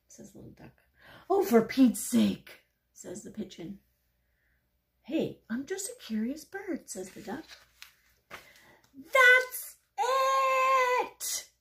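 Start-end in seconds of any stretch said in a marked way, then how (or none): 1.3-2.6 loud, slow fed-up groan of words; exasperation. Acted. Pigeon fed up with the duck's questions, exasperated. 5.0-6.9 high, light, innocent protest; innocent duck. Acted. Little duck excusing its questions to the pigeon, innocent. 8.9-11.5 high, loud, stretched-out yell; exploding pigeon. Acted. Pigeon snapping at the curious duck, furious yell.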